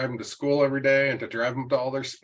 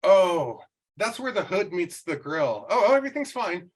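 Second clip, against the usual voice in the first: put-on high, breezy teen voice; imitating the daughter, high and relieved; daughter realizing; 6 semitones higher than usual. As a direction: she suddenly sees there is no damage and bails. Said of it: "then suddenly she's like".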